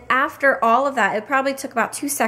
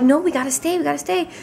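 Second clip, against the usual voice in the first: put-on higher, gentle coaxing to a toddler; mock soothing; 6 semitones higher than usual. Parents coaxing their son to stay, gently and higher. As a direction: coax a little boy to stay put.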